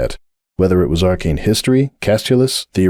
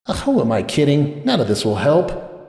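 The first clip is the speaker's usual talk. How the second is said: soft, higher, slowing groan of despair; 6 semitones higher, 4 dB quieter than usual. A narrator's reading. Sunburst at the bar, soft and hopeless. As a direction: give up on the night.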